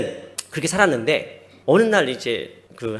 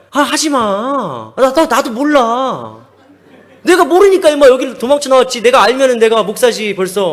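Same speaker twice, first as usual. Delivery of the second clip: loud, higher mock-annoyed retort; exasperated brush-off; 6 semitones higher, 8 dB louder than usual. Runaway seminarian tells friend to stop asking, exasperated. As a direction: push the questioner away, voice raised.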